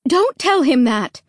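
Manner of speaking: high, loud scolding cry; sharp cry. Acted. Mom scolding dad for scaring Clay, sharp cry. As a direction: snap it out to stop him. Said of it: "Mom cried sharply".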